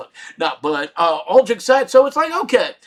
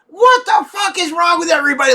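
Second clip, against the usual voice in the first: high, loud frustrated yell; exasperated shout; 9 semitones higher, 4 dB louder than usual. Pretend. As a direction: explode at everyone. Imagined exasperated outburst.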